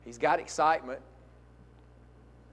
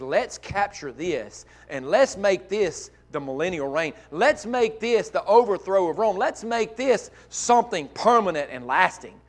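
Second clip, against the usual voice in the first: excited Peter; 6 semitones higher, 8 dB louder than usual. Retold or imitated. Peter's excited plea, retold.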